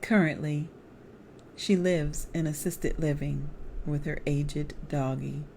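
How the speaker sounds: plain reading voice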